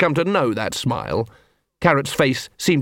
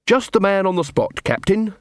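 higher, chirpy greeting; cheerful; 7 semitones higher than usual. A narrator's reading. Carrot greeting the captain, cheerful. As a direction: lift the pitch, cheerfully. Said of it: "said Carrot cheerfully".

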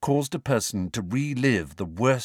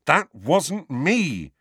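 high, slow, emphatic protest; less calm; 8 semitones higher, 4 dB louder, much slower than usual. A narrator's reading. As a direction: insist it with rising irritation. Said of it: "slightly less calmly".

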